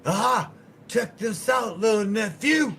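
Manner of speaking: high, loud and slow, bragging holler; imitating a loud uncle hollering at his little nephew; loud mock uncle shout. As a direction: bellow it like a rowdy uncle showing off. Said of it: "he's like, he's like".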